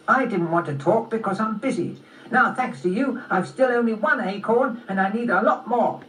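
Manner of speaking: high, complaining squirrel voice; busy squirrel airing a grievance; irritated squirrel. Acted. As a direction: grumble like an interrupted squirrel. Squirrel complains of being interrupted.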